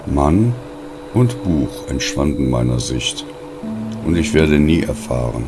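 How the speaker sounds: plain reading voice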